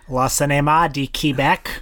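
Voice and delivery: Le Cinéma du Québec in a Quebecois accent. Shown as a demonstration. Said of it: "That's my, uh, Quebecois accent".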